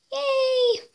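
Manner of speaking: high, drawn-out cheer; little cheer. Real, not acted. Vlogger wrapping up her Christmas gift update, high cheer.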